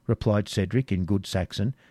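ordinary delivery, nothing marked

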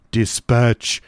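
drawn-out, mildly fierce command; teeth-gnashing cry. A narrator's reading. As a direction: order it through gritted teeth. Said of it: "cried the figure, gnashing its teeth".

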